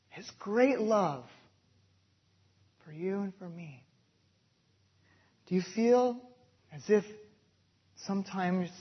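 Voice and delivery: ordinary talk, nothing marked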